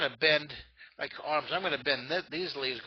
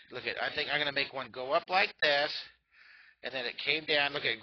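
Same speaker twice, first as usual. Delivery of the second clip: ordinary delivery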